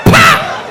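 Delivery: sharp, high smack sound; loud slap noise. Acted.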